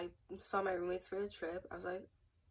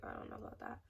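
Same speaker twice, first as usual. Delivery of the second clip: whispered, much softer aside to herself; doubtful whisper; 11 dB quieter, faster than usual. Retold or imitated. To herself while packing, a doubtful whisper. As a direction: breathe it out as a private doubt.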